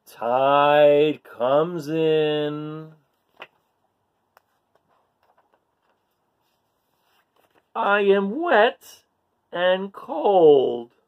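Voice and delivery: slow, expressive storybook reading; reading to children. A narrator's reading. Storyteller reads to kids, slow and expressive. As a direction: paint each line for little listeners.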